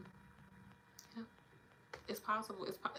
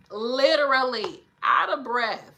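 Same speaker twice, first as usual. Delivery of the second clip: louder, slow and insistent; emphatic; 4 semitones higher, 9 dB louder, slower than usual. Real, not acted. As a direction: drive each word home, slowly. Emphatic repeat about kids.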